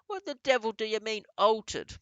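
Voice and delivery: high, loud demanding bellow; angry shout. A narrator's reading. Father shouting at the grandmother for an explanation, furious. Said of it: "shouted Mister Jenkins".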